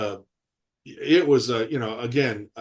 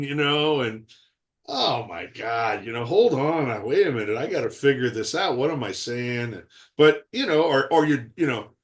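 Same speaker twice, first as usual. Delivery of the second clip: ordinary delivery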